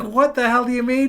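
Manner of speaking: high, loud retold incredulity; exasperated retort. Parent arguing with daughter over a stuck car, exasperated. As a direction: push the pitch up in frustrated disbelief.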